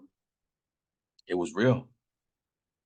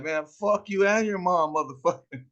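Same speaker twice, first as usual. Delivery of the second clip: mock taunt; 8 semitones higher than usual. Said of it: "And Oscar's like".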